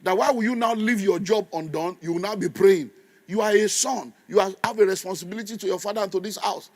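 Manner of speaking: ordinary talk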